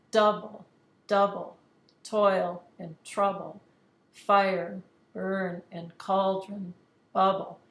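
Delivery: slow, separated beats, "DOUble, DOUble", falling away; exaggerated trochaic stress. Shown as a demonstration. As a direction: hit the first syllable of "Double", "trouble", "bubble" loud. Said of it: "the stressed syllable now is coming first".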